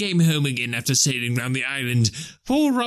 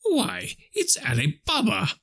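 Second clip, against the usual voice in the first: high-pitched delighted cry; thrilled old Scrooge, pitched way up; ecstatic exclamation; 3 dB quieter, slower than usual. A narrator's reading. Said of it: "Scrooge exclaimed with ecstasy".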